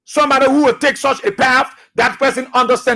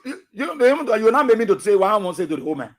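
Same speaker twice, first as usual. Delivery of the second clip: ordinary talk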